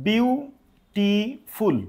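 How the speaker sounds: "বিউটিফুল" very slowly in separate chunks, biu, ti, ful; teacher's syllable-by-syllable "বিউটিফুল", drawn out slowly; broken into syllables. Shown as a demonstration. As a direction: split "বিউটিফুল" into three parts with clear gaps. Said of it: "ভাঙে ভাঙে হচ্ছে না?".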